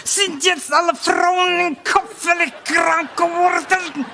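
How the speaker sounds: high, slow, shouting German rant; Hitler impression. Comedian as Hitler raging about modern women, shouting in German. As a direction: rant in German like a furious dictator. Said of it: "even Hitler would go".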